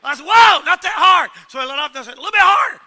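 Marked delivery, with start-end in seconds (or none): 0.3-1.3 loud, high whoa, dragged out; loud slowed warning yell; retold yell. Yelling at the boy pulling the belt. 2.1-2.8 retold call. Yelling instructions from the driver's seat.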